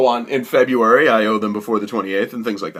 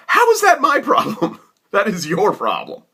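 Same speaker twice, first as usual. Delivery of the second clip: much higher, outraged question; indignant squeak; 9 semitones higher than usual. Retold or imitated. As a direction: toss it back at him in disbelief. Retold indignant retort to caller.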